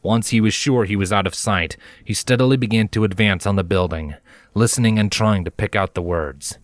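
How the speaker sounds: ordinary delivery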